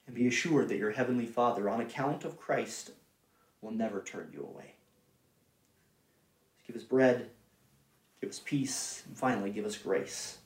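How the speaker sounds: soft, low, solemn preaching; hushed assurance. Real, not acted. Preacher assuring believers the Father never turns away, hushed assurance. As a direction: reassure them quietly, voice sinking low.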